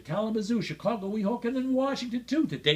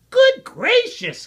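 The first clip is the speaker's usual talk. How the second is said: very high, loud, slow gasp; gasping surprise; 11 dB louder than usual. A narrator's reading. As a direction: gasp it as the startled bird. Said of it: "gasped Maisie".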